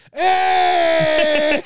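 sudden high shout across the bar; toilet attendant shout. Retold or imitated. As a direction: bark it out loud and high. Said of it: "Jesus, that's an impression".

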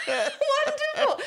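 high, slow gushing word through a laugh; Lady Diana laughing. Retold or imitated. Laughing at herself, repeating it in a high, slow royal gush. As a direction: stretch it out royally while cracking up. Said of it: "I turned into fucking Lady Diana".

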